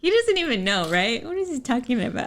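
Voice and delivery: high, playful protest; mock indignation. Real, not acted. Wife playfully protests her husband's version of the story, high and teasing. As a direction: tease him with high mock outrage.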